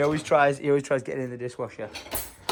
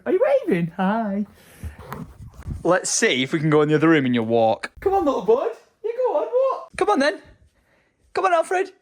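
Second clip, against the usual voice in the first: much higher sing-song coaxing; 6 semitones higher than usual. Real, not acted. Coaxing baby to walk.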